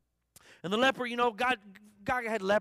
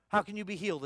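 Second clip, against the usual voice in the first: ordinary talk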